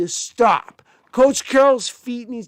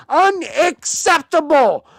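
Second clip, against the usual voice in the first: higher, slow, louder unacceptable; slow angry verdict; 6 semitones higher, 4 dB louder, slower than usual. Real, not acted. Fan condemning his team's play, slow and angry. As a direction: hammer the word down slowly. Angry rant about team.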